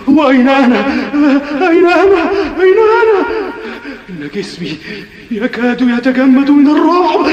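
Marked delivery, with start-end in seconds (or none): 0.1-3.3 each cry more lost than the last; rising panic. Acted. (ذعر متصاعد)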